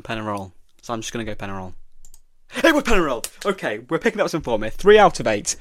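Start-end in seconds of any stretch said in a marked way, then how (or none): 2.6-3.3 real, high, loud burst of triumph; excited cheer. Real, not acted. Quiz player learns his guess was right, excited. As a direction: celebrate a surprise correct answer loudly.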